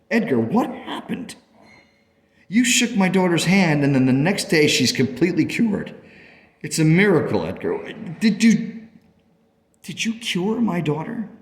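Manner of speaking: high-pitched urgent questioning; astonished, pleading. Acted. Parent asking Edgar whether he cured the daughter, astonished. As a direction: plead for the truth in disbelief.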